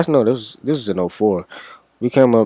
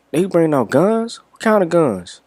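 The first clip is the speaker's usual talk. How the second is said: pitched up, eager questions; loud eager questions; 8 semitones higher than usual. Retold or imitated. Talking to friends about guns, eager loud questions. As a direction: blurt it out too eagerly. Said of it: "And my loud ass".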